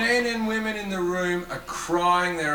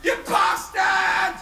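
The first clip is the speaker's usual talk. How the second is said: screamed insult, slow and drawn out; furious yell; 13 semitones higher, 4 dB louder, much slower than usual. Retold or imitated. Audience woman screaming at the comic. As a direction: hurl it at him, high and raw.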